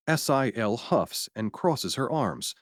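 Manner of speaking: usual speaking voice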